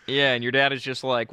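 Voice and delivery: ordinary talk, nothing marked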